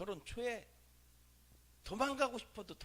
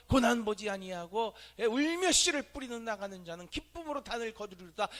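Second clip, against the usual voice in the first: preaching recital; 3 semitones higher, 6 dB louder than usual. Real, not acted. Reciting scripture while preaching.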